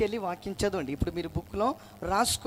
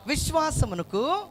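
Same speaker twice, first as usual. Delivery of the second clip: slow, higher call with a rising end; drawn-out prompt; 7 semitones higher, slower than usual. Shown as a demonstration. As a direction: cue the congregation by stretching the word. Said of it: "అయితే కొంచెం గట్టిగా చెప్పండి".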